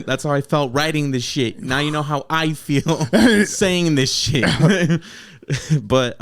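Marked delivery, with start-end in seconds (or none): 1.6-4.4 laughing. Real, not acted. Laughing at his own rude bars. 4.5-5.0 laughing. Real, not acted. Laughs after host's laugh.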